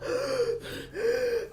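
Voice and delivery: sharp gasp for air; high breathless gasp. Real, not acted. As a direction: suck in air, winded. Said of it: "I'm out of breath".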